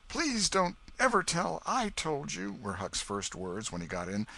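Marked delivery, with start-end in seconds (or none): 0.1-2.6 put-on high, slow pleading boy's voice; a frightened boy begging for secrecy; scared boy Huck. Huck begs the old man to keep his name secret, high and scared.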